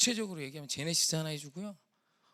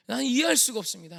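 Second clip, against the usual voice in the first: high, loud retold cry to God; loud protest; 6 semitones higher, 10 dB louder than usual. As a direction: raise the voice in anguished protest. Same protest, escalated to a cry.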